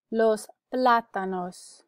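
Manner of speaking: "Los plátanos" slowly, stress on "plá"; slow, deliberate "Los plátanos" for repeating; careful pronunciation model. Shown as a demonstration. Teacher gives the word for bananas, slow careful model. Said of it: "You can repeat for pronunciation".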